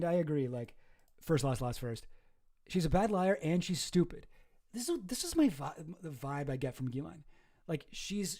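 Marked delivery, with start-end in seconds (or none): none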